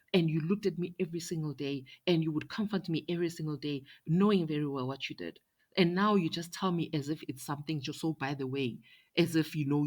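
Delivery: loud, high, heated accusation; angry confrontation. Retold or imitated. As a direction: pour out the hurt in rising anger. Confronting sister over sacrificed son.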